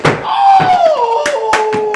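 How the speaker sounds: very long, loud, high "oh"; horrified howl. Retold or imitated. Doctor jumping around after exam, long mock howl. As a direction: wail it out in comic horror.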